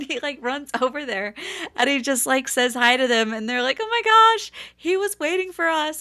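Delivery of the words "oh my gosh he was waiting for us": high-pitched imitated fan gushing; thrilled fans. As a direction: gush like a starstruck fan. Retelling thrilled fans' reaction.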